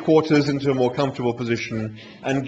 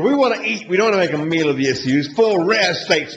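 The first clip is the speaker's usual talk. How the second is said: louder, higher, brash demand; impatient Zaphod; 6 semitones higher, 6 dB louder than usual. A narrator's reading. Zaphod ordering steaks from the animal, brash and impatient. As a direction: cut through the fuss and order.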